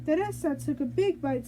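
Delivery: plain reading voice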